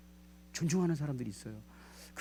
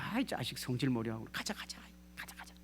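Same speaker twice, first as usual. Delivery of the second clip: behind-the-back grumbling; 2 semitones lower, 9 dB quieter than usual. Pretend. Servant grumbles behind his master's back, hushed muttering. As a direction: mutter it so the master can't hear. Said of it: "뒤에 가서 이랬을 거예요".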